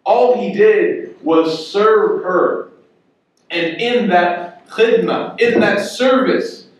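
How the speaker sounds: slow, deliberate preaching beat; drawn-out emphatic sermon line; slow emphasis. Real, not acted. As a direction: lean on each word slowly. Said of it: "slow emphatic preaching point".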